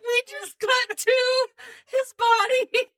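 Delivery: slow, high voice on the edge of giggles; laughing disbelief. Real, not acted. Co-host reacting to the cut to the body, laughing disbelief. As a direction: squeeze it out high through suppressed laughter. Still cracking up at abrupt cut.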